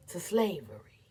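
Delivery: soft grieving whisper. Acted. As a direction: barely breathe the words. Grief over children sold off.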